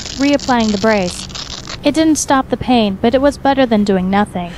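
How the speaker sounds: ordinary delivery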